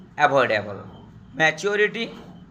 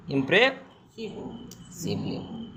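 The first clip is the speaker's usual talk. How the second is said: very slow dictation; 6 dB quieter, much slower than usual. Shown as a demonstration. Teacher dictating the spelling to students: very slow and soft. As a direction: draw ইমপ্রেসিভলি out very slowly and softly. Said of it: "হ্যাঁ?".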